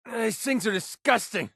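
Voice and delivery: high-pitched disgusted cry; revolted exclamation. Acted. Revolted combat bark.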